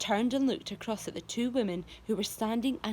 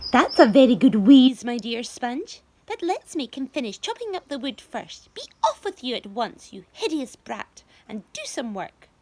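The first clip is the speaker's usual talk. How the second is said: nasty aunt; 3 semitones higher, 12 dB louder than usual. A narrator's reading. Spiker voiced in story.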